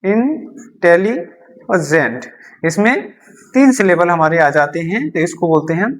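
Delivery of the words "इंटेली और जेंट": slowly splitting इंटेली और जेंट into syllables; slow syllable split. Shown as a demonstration. Teacher to students, slowly splitting a word into syllables. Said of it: "हमारे three syllable हो गए".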